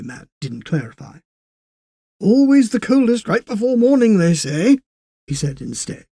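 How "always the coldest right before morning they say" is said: much higher, louder folksy saying; an old man sharing folk wisdom; wise old railwayman. Acted.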